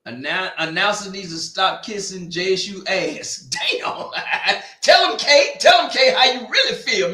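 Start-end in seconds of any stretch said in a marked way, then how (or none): 4.8-7.1 shouted, high, laughing encouragement; excited cheering. Real, not acted. Streamer hyping up the announcer Kate, shouting with glee. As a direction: egg her on with a big hype shout.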